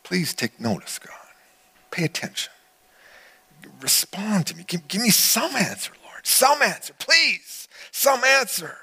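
a dying man begging God, fading to a high cry; pleading prayer. Acted. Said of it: "he brings his request to God".

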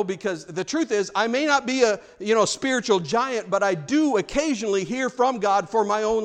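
loud, high, driving sermon voice; preaching at full force. Acted. Pastor urging the congregation, preaching loud and high. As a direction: pound it home from the pulpit.

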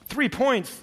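can't believe the tally; incredulous. Acted. Man at heaven's gate stunned by points, high disbelief. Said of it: "said the man incredulously".

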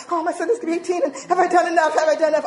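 high, loud, hammed-up parental worry; anxious fretting. Mother fretting as her son turns eighteen, mock-panicked. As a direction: fret aloud with rising urgency.

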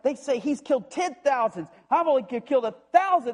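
high, indignant whine; jealous Saul. Acted. Saul hearing the women sing of David, jealous whine. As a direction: spit it out, stung by the praise.

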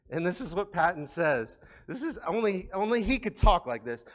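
usual speaking voice

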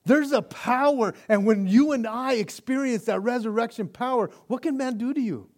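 loud, high, driving sermon voice; full-force preaching. Acted. Pastor preaching Peter's courage to the congregation, booming. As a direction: preach it out with rising fire.

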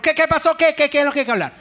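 loud, high, hammed-up yelling; mock shouting husband. The shouting spouse yells at the pacifist in a mock loud, high rant. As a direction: bellow back at the spouse in a playful rage. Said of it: "es el gritón".

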